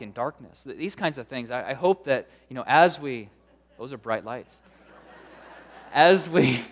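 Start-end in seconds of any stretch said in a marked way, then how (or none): 5.9-6.6 laughing. Real, not acted. Laughs at audience silence.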